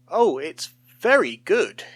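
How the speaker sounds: slow, loud, strained approval; forced praise. A narrator's reading. Paul covering his panic before the men, slow and forced. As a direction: force the compliment out slowly. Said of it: "he said aloud".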